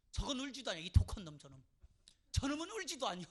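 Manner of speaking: loud, indignant mock condemnation; villagers' reproach. Villagers condemning a son who won't cry, loudly scandalized. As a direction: denounce the dry-eyed son out loud. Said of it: "동네 사람들".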